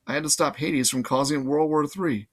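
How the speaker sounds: usual speaking voice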